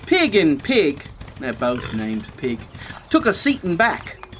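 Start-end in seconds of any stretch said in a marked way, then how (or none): none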